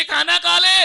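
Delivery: high, loud call to the father; child relays mother's message loudly; son calling loudly. Retold or imitated. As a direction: shout it up to him across the house.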